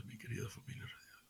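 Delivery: hushed, intimate whisper to the listeners; breathy confiding whisper. Real, not acted. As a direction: lean in and whisper it to the radio family. Preacher confides to radio audience.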